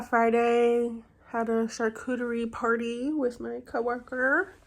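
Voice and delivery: ordinary talk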